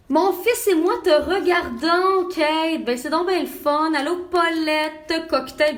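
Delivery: high, loud, drawn-out hellos; excited greeting. Real, not acted. Mother greeting live viewers by name, excited. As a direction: call out brightly to viewers, high and stretched.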